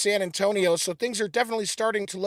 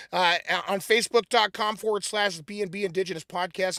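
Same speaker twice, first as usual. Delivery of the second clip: ordinary talk, nothing marked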